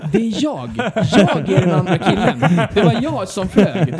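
loud, high reenacted protest with laughter around; exasperated exclamation. Rider revealing the mix-up to locals, loud exasperated retelling.

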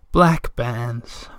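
slow, drawn-out mother's answer; sleepy mother. A narrator's reading. Sleeping mother answers whispered question.

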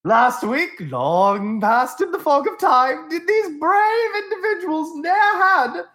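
pompous olde-English showman, high and loud; hammy stage actor. Acted. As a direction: ham it up like a bad Shakespearean actor. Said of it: "I was making fun of Gavril".

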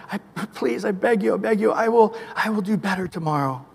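panicked plea that dies away to near silence; frantic begging prayer. Retold or imitated. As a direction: beg God for mercy, then sink into frightened murmurs. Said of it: "and we're going".

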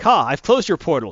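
gloating, loud and proud; triumphant gloat. Retold or imitated. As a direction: crow it like you won.

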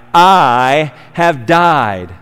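very slow, "I" held long; stress on I. Shown as a demonstration. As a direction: drag out "I", then fall. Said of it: "An emphasis on the I".

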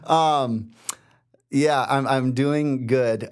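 teary laugh, voice on the verge of crying; fighting tears while laughing them off; holding back tears. Real, not acted. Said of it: "I'm trying not to cry right now".

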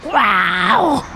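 high shrieking skeleton cry; skeletal archer noise. Acted. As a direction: screech like a dead archer. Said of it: "pretty good skeletal archer noise".

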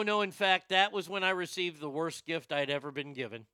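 ordinary talk

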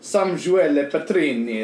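slow, drawn-out Italian name; Italian pronunciation. Shown as a demonstration. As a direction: say it with a proud Italian flourish. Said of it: "how you say my name in Italian".

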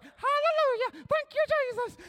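very high, hooting praise; excited churchgoing grandma. Retold or imitated. Grandma in the pew at church, ecstatic praise. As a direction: squeal it out as an ecstatic worshipper. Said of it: "just sitting there all excited".

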